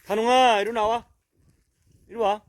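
high, loud coaxing call to a dog. Real, not acted. Owner calling his hiding dog to come out, loud and high. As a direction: coax the hiding dog out from afar.

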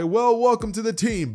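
high, loud, booming hero greeting; hearty welcome. Retold or imitated. All Might slapping Bakugo on the back, hearty welcome. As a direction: welcome them with big mock-heroic gusto.

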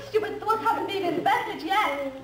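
usual speaking voice

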